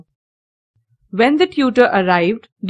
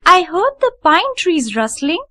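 higher, a lightly voiced small girl; dreamy little girl Heidi; 8 semitones higher than usual. A narrator's reading. Heidi explains to the housekeeper, a small girl's wondering voice. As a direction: answer simply, lost in a daydream.

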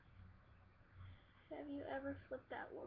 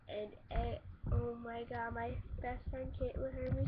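normal talking voice

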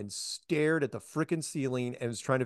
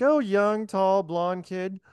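lightly voiced Cammy; 7 semitones higher, much slower than usual. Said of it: "She goes".

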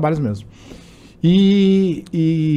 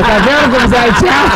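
mock gloating; 5 semitones higher, 6 dB louder than usual. Man triumphantly mocks the teller.

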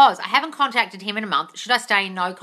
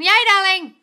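loud, high hurrah; high, pleased whoop; excited cheer; 10 semitones higher, 8 dB louder than usual. Real, not acted. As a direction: cheer them on brightly and loudly. Cheering a viewer booking a session.

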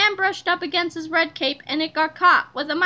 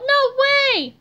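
high, loud, emphatic refusal; crying out; 10 semitones higher, 5 dB louder than usual. A narrator's reading. Harold refusing George, crying out. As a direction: cry it out in protest. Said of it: "cried Harold".

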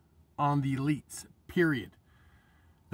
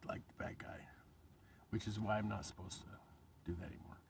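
whisper; 7 semitones lower, 16 dB quieter than usual. Real, not acted. Vlogger trailing off about not being the bad guy, whispered. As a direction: drop to a near whisper.

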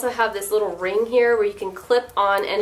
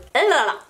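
light laugh; 6 semitones higher, 4 dB louder than usual. Real, not acted. Laughs at own slip.

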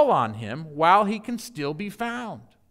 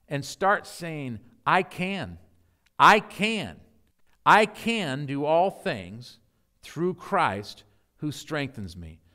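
prayer; 6 semitones lower than usual. Acted. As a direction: pray gently over the congregation. Closing prayer.

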